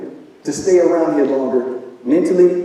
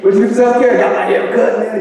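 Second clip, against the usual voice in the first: laughing quiz; 5 semitones higher, 3 dB louder than usual. Acted.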